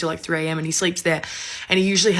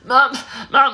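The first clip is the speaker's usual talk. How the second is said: higher, strained mock call; imitated lost voice; 4 semitones higher than usual. Girl to mum, unable to speak, strained imitation. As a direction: squeeze the word out with little voice. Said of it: "my voice came back".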